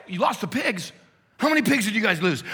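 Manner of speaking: high, loud, fast disbelieving shout; shocked townsman. Acted. Investors demanding answers from the herders, shocked shout. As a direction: fire the questions in disbelief.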